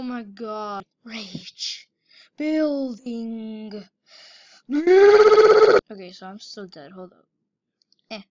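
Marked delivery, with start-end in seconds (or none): 4.7-6.1 long loud yelled okay; shouted okay. Real, not acted. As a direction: belt it out at the screen. Gamer yells at the game.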